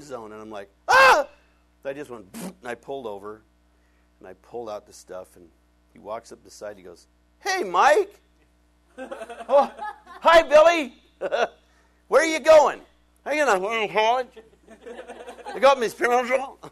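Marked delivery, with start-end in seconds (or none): 0.9-1.3 high loud yelp of alarm; panicked yelp. Retold or imitated. Driver yelps at a police officer's lights behind him. 7.4-16.6 high, loud and laughing; laughing exchange. Retold or imitated. Pastor retells meeting his friend the officer, laughing loudly.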